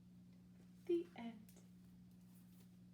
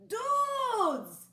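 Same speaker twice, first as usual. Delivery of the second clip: much higher, loud excited shout; 14 semitones higher, 11 dB louder than usual. Acted. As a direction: yell it out to your buddies. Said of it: "shouted Scapito".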